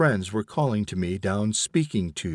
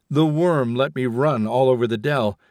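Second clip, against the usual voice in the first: plain reading voice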